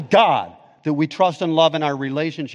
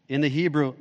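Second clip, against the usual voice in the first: ordinary talk, nothing marked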